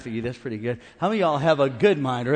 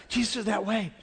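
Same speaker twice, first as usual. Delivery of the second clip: ordinary talk, nothing marked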